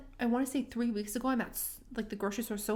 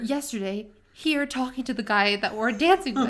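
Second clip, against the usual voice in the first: loud, incredulous retold outburst; indignant disbelief; 6 dB louder than usual. Retelling her indignant disbelief.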